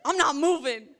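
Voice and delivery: stubborn refusal. Retold or imitated. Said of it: "I told him".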